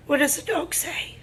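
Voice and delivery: ordinary delivery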